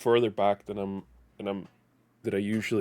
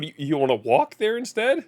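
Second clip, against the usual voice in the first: usual speaking voice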